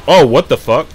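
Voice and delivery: loud surprised curse; startled outburst. Real, not acted. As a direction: jolt at a sudden shock. Startled by the game.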